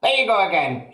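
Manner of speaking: Grandma crying out. A narrator's reading. Grandma scolding George, high and loud. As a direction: snap like a cranky grandma. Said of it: "she cried".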